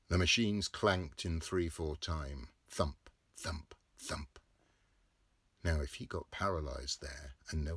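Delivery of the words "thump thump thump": fading whispered thumps. A narrator's reading. Narrator echoes the press in whispers. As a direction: let the thumps die to a whisper.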